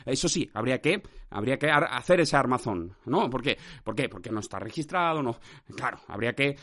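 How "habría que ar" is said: ordinary talk, nothing marked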